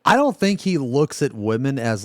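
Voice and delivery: ordinary talk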